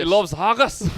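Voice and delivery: high, loud mock Scottish; put-on Scottish accent. Co-host playing along with the teasing, mock Scottish accent. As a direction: exclaim it in a daft Scottish accent. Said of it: "that's a bit Scottish".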